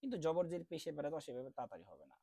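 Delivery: ordinary talk, nothing marked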